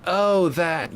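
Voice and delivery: sudden realisation. Real, not acted. Realises what chat meant.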